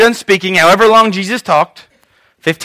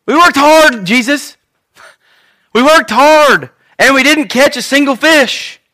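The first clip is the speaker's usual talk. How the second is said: pleading fishermen; 7 semitones higher, slower than usual. Retold or imitated. Simon pleading with Jesus.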